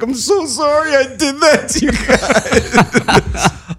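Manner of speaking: very high, slow, laughing mock sob; hammed-up weepy falsetto, laughing through it; tearful apology. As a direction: imitate him crying his apology. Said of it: "He's like".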